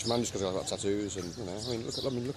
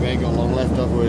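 louder call; 3 semitones higher, 13 dB louder than usual. Real, not acted. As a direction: shout it out.

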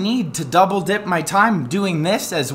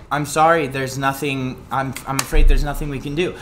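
mild apologetic clerk; 5 semitones lower than usual. Retold or imitated. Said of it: "she's like".

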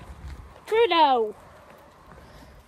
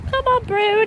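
high, loud call to the dog; 9 semitones higher, 7 dB louder than usual. Real, not acted. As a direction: call him on, louder and higher. Dog refusing to walk.

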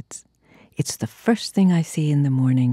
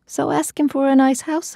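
nagging wife; 11 semitones higher than usual. Retold or imitated. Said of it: "I say".

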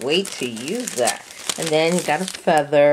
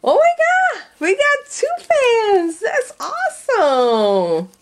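high, loud shout of joy; excited shriek; 5 dB louder, slower than usual. Real, not acted. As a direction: yell with thrilled delight. Finds two fans in the box.

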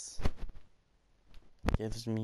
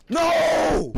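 very high, loud shout of dismay; anguished shout; 10 dB louder than usual. Real, not acted. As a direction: yell as the jump fails. Fails a jump, shouts.

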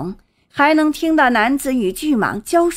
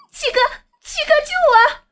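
very high, urgent scream to be rescued; terrified cry for help; faster than usual. A narrator's reading. Sister caught by the python calls brother, terrified shriek. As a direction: cry out desperately, voice shooting way up.

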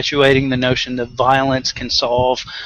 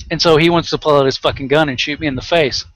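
ordinary talk

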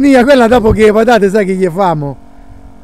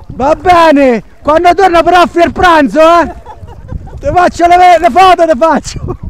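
raised voice across the water; calling out; 6 semitones higher, 4 dB louder, slower than usual. Real, not acted. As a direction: call to someone far away. Calling to woman from distance.

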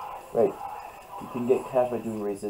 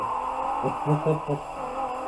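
higher-pitched chuckle; self-amused laugh; 6 semitones higher than usual. Real, not acted. Gamer admitting he quits when he loses, amused laugh. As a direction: laugh at your own confession. Admits being a sore loser.

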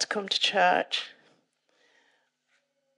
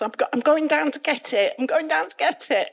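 high, louder, eager rush; retold urgent excitement; 6 semitones higher, 5 dB louder than usual. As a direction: announce it as if dashing off.

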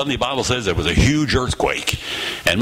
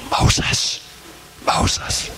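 hushed, voiceless whisper of the name; God's whisper. Acted. God calling Moses in the still desert, whispered. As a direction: whisper it twice, gently spaced. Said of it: "did God say".

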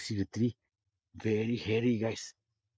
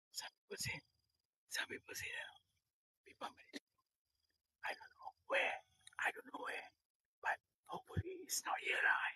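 soft, breathy whispered greeting; 19 dB quieter than usual. Real, not acted. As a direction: hush it so nobody hears. Whispering near people meditating.

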